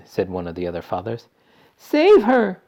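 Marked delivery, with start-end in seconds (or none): 1.8-2.6 very high loud cry; desperate cry. A narrator's reading. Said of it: "cried Missus Beauregard".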